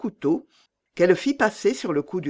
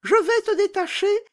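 much higher, loud and blunt; bold robber girl; 3 dB louder than usual. Acted. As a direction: toss it off like a fearless bandit girl.

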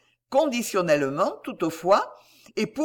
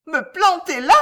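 high, loud outraged question; enraged cry; 10 semitones higher, 9 dB louder than usual. A narrator's reading. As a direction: shriek it in outrage. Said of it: "cria-t-elle en tremblant de rage". (cri de rage aigu)

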